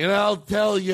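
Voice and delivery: put-on high ranting drunk; drunk uncle rant. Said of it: "hearing him complaining".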